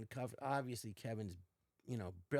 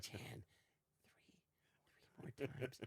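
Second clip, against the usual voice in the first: hushed aside; 11 dB quieter than usual. Retold or imitated. Whispering to castmate during bombing sketch.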